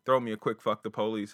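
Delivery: ordinary delivery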